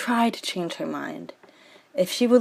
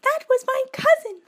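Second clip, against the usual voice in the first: wailing Juliet. Acted. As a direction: cry out in horror at the deed. Juliet horrified.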